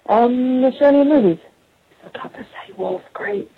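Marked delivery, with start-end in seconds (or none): 2.0-3.5 whispered aside, slow. Real, not acted. Caller whispering into her voicemail. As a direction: lean in and whisper it, slowly.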